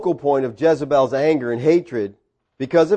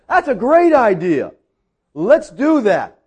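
lightly acted crowd cheer of assent, pitched up; crowd's eager agreement; 6 semitones higher, 4 dB louder, slower than usual. People answering Elijah's contest, acted eager assent. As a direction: agree as a whole crowd, high and emphatic. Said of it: "all the people said".

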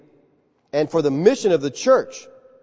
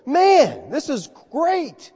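high, slow "this is great" in wonder; awed Peter; 9 semitones higher, much slower than usual. Acted. Peter amazed by Gentile salvation.

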